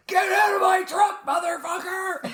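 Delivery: angry shout. Retold or imitated. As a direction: scream it at the thief. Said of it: "and it's like".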